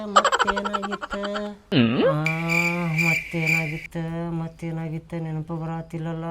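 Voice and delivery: very soft, low self-talk; musing to himself. Acted. Uncle trying to recall another story, murmuring to himself. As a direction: murmur it low while searching your memory.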